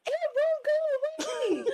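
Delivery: put-on high, wailing child's cry; panicked little boy. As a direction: squeal it like a scared toddler. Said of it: "And he's saying".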